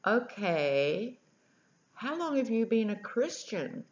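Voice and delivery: mock-baffled questioning; wheels turning. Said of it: "you could see the wheels turning".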